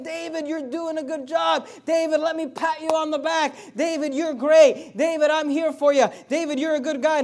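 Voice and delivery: high, loud cheering friend; Jonathan encouraging. Acted. Jonathan shouting encouragement to David in hiding. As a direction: lift David up like a coach.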